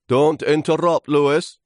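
high, loud, bossy character voice; scolding branches. Acted. Branches to interrupting leaf, high bossy scolding. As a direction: cut him off, high and loud.

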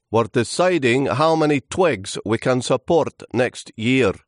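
high, loud character voice; busy, bossy branches. Acted. Busy branches to Lewis, high self-important dismissal.

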